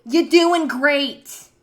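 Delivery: slow, loud sarcastic yell. Acted. Yelling sarcastic praise at her doctor, slow and loud. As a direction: bellow the praise mockingly, slowly.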